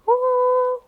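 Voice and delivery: high ooh, up high and loud. Shown as a demonstration. Teacher showing kids their high voice, high and bright. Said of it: "we use our high voices".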